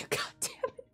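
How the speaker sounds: high whispered squeak; breathless squeal. Real, not acted. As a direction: squeak it out, overwhelmed. Squealing again after laughing.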